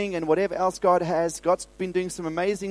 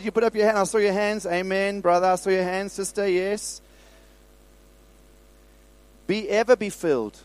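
ordinary talk

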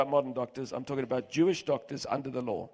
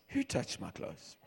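"Who touched my clothes?" asked much softer, lower and slow; soft question; 3 semitones lower, 11 dB quieter than usual. Shown as a demonstration. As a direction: ask it gently, almost to himself. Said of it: "Or maybe he said it like this".

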